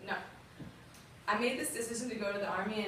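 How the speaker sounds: ordinary talk, nothing marked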